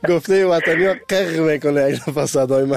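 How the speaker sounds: words spilling out through a laugh; laughing while talking. Real, not acted. Radio host tells guest his voice cracks, laughing.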